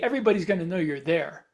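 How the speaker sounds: ordinary delivery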